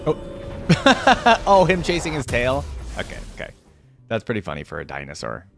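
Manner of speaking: normal talking voice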